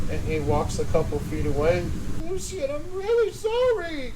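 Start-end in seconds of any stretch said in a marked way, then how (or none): 2.3-4.1 high, tearful confession; crying Clevis. Acted. Clevis to his captor, high and weepy. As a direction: sob it out while justifying.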